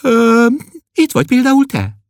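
put-on high, bright girlish answer; girl's voice. Klopédia answering Berzsián, high and bright. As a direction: point at him cheerfully.

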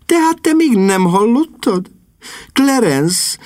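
agitated. Acted. Sister breaks bad news.

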